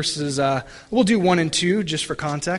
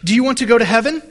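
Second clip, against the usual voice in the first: a louder, higher, earnest street-preacher question; eager pastor; 5 semitones higher, 6 dB louder, much faster than usual. Retold or imitated. Pastor to three teenage boys, an earnest street question. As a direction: call out to teens on the street. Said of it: "he asked them this question".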